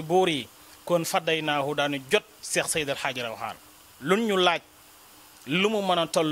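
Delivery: impassioned religious exposition. Real, not acted. Preacher explaining the elegy's verses to viewers, impassioned exposition. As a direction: expound each verse forcefully, starting phrases high and loud.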